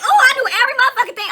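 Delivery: high, loud and fast, crowing over being right; gloating. Retold or imitated. Bragging to a friend about old videos, loud fast gloating. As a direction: crow it out like you called it all.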